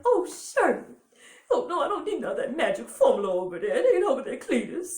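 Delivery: high, loud hammed-up movie mama; warbling high matronly character, half laughing; Mama Klump. Imitating mama refusing the magic formula, high hammy voice. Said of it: "Mama Clump is my favorite".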